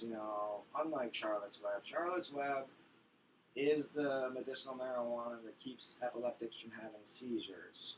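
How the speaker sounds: ordinary talk, nothing marked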